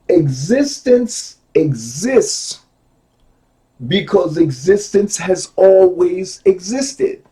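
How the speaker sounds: deliberate reading with long pauses; slow emphatic reading. Real, not acted. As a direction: take your time over every word. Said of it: "take my time and go slow".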